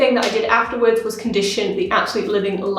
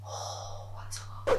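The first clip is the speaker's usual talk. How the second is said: shocked whisper; 16 dB quieter, slower than usual. Real, not acted. Shocked at rinsed dye.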